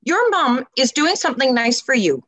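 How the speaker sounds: high-pitched, pointed "excuse me" reprimand; acted-out polite telling-off; stepping in to scold. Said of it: "I go up to them all the time".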